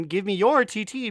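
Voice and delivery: normal talking voice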